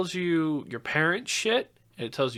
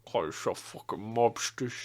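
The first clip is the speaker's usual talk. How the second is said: put-on British accent; 5 semitones lower, 9 dB quieter than usual. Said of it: "what did he say?".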